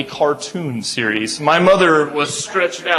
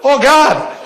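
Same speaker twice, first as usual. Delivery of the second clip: exasperated groan; 11 semitones higher, 5 dB louder than usual. Real, not acted. Recalling the chaotic truck chase.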